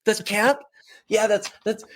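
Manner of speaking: high, eager sidekick voice, pushing for agreement; imitating Dean trying too hard to chime in; mock hype-man voice. Dean eagerly backing up the host on stage, high hype-man voice. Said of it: "like, trying to, like".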